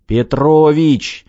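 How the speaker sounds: drawn-out rousing shout; urgent call. A narrator's reading. Director shakes his slacking partner awake, drawn-out shout.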